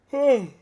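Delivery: high, loud sigh. Real, not acted. Teacher working out the next problem, loud sigh. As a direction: let out a big, audible sigh.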